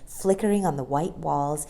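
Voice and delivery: ordinary delivery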